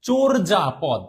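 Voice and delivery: চোর যা পদ measured out, "chor-ja-pod"; correct closed O pronunciation. Shown as a demonstration. Teacher repeating the corrected word, চোর যা পদ as "chor-ja-pod". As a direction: repeat চোর যা পদ with a rounded first "o". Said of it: "এটা হচ্ছে চোর".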